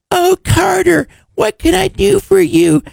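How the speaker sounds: very high, babying mock voice; Beth, doting. Beth fussing over Carter, mocked as high and doting. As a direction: coo in a squeaky, hammed-up fawning voice. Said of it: "And now she's like".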